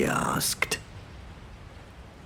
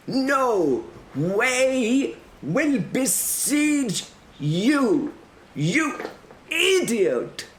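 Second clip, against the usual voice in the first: put-on high, slow exasperated accented yell; herald's yell; 3 dB louder, much slower than usual. A narrator's reading. Herald spells out threat for defenders, exasperated yell. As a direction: yell each word drawn out in fury. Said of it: "the man yelled".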